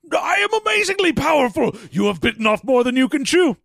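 high, booming mock-villain boast; boastful villain. Rahatan boasting to Storm, hammed-up shouted villain brag. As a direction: puff up and proclaim your power. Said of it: "He's like".

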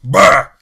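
loud growling Brr; bear growl. Retold or imitated. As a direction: bark a sharp bear growl. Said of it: "He's always just like".

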